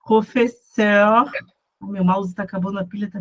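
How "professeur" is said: "professeur" drawn out slowly, every sound clear; slow demo. Shown as a demonstration. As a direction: spell out "professeur" slowly for the class.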